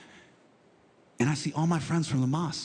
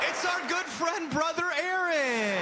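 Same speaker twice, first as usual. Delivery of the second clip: gloating villain; 13 semitones higher than usual. Retold or imitated. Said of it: "I go".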